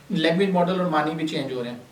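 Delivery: usual speaking voice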